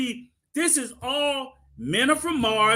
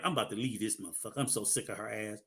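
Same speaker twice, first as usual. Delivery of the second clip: very low, soft and fast grumble; muttered aside; 13 semitones lower, 13 dB quieter, faster than usual. Retold or imitated. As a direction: grumble it under your breath. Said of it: "sometimes I'm like".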